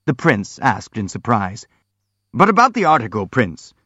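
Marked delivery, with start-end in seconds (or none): 2.3-3.7 pitched up, pushy boxer jumping in; eager interruption. A narrator's reading. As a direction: break in loud and high. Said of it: "the boxer broke in".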